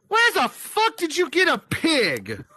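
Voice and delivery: high-pitched disbelief through laughter; incredulous bouncer. Retold or imitated. Bouncer confronting the man carrying a pig, mock-incredulous. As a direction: demand an answer in laughing astonishment.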